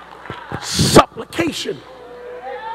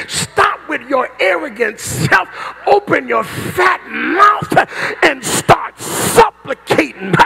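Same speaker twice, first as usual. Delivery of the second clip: forceful preacher's command, slowing on key words; commanding pulpit shout; full-force exhortation; 4 semitones higher, 4 dB louder, slower than usual. Real, not acted. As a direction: preach it hard and stretched out.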